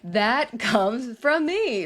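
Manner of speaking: slow, laughing confession. Retold or imitated. Mom admitting to a guest, laughing. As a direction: laugh it out slowly, owning it. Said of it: "And I'm like".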